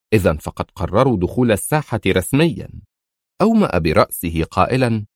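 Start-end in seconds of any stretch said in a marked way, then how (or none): none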